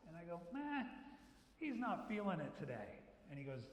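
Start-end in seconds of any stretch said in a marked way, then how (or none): 0.5-3.0 feigned indifference to the manager; mock shrug. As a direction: play it off as no big deal.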